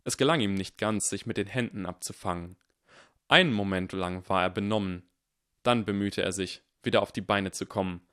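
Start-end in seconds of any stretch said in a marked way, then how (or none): none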